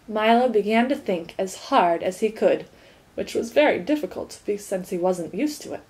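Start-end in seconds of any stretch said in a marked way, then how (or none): none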